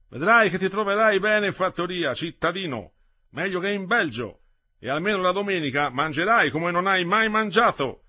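high, loud jovial country voice; hearty farmhand. Acted. Farmhand promising Metello good food at the farm, loud and hearty. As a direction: cheer the city boy up broadly.

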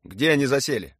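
officer's pressing question; urgent question. A narrator's reading. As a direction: demand the answer quickly. Officer questions interpreter under pressure.

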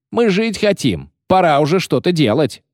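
high, insistent complaint; agitated protest. A narrator's reading. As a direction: rouse the men to mutiny. Mutinous sailor protests. (возбуждённый протест)

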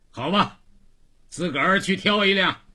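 high, loud go-ahead; boss granting permission. Acted. Boss lets Xiangzi choose a rickshaw, loud brisk consent.

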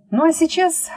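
ordinary delivery, nothing marked

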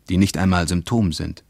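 usual speaking voice

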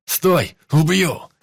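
yelling. Acted. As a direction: scream after her while running. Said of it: "завопил Шкуркин".

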